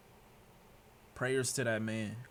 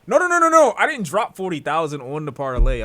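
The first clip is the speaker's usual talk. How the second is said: high, loud rapid no's; loud denial; 5 semitones higher, 15 dB louder than usual. Real, not acted. Streamer loudly correcting chat about the parlay, shouted denial. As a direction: shout down the rumor.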